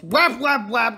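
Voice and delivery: loud, high "whap" hits; play-acted spider bashing; mock whacking noises. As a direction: punch out each whap like a blow. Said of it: "and he goes".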